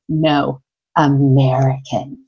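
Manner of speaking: low, slow and far from her own voice, dripping scorn; low, slow sneer at a nationality; deep disdain. Retold or imitated. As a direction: say the country like an insult, lightly imitating. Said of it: "with the most deep disdain".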